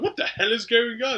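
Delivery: high-pitched retold alarm; mock bewildered outcry. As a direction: act out a startled onlooker. People burst into the room.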